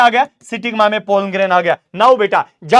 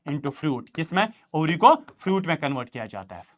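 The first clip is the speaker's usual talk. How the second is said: quiet dictation; 5 semitones lower, 6 dB quieter than usual. Shown as a demonstration. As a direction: lower and softer dictation for notes. Teacher dictates answer quietly. (Notes के लिए धीमी, नीची आवाज़ में लिखवाना)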